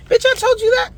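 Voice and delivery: very high-pitched, exasperated outburst; exasperated retort. Acted. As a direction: squeal it like you can't believe they forgot. Acted exasperated retort to people.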